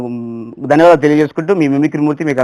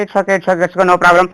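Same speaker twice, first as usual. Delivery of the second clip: normal talking voice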